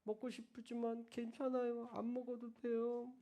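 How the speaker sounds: soft, very slow little voice; meek child. Retold or imitated. A hint of a shy child holding back a wish, soft. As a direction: sound small and pitiful. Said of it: "떼쓰는 애보다".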